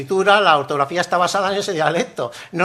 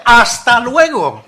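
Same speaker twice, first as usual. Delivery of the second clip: loud, slow, over-articulated goodbye; pointedly full "hasta luego", high and forceful; fed-up farewell; 5 semitones higher, 10 dB louder, much slower than usual. Acted. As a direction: send them off, sick of them. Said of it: "Oye, ya estoy harto de ti".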